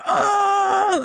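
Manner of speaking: long high-pitched cry of disgust; imitated horrified groan. Crew watching him eat it, horrified groan. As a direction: wail it like a revolted crowd. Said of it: "everyone's like".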